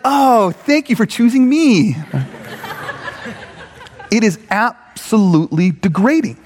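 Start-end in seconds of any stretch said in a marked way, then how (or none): 0.1-2.0 mock gratitude. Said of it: "They're like".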